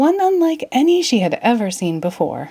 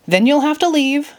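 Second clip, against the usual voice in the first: crossly; 7 semitones higher than usual. Acted. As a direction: show her the window, a touch huffy. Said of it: "the Mad Hatter said crossly".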